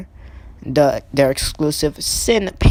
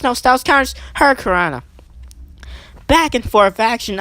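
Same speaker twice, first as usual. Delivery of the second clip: out of breath; 8 semitones higher, faster than usual. Real, not acted. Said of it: "I'm running out of breath here".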